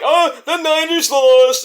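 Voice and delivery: mock people gloating over the loss; hammed-up taunting voice; mocking rival. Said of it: "People, people like".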